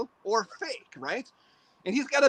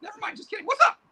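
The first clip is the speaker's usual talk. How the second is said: a high cackling boogeyman; boogeyman puppet voice; 13 semitones higher, 5 dB louder, faster than usual. Acted. Puppet boogeyman greets the interviewer in a high creepy voice. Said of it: "Hey, boogeyman, come over here".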